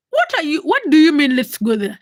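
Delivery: high, loud startled question; can't believe what she heard; shocked disbelief. Retold or imitated. Teenage girl startled by her mother's plan, shocked.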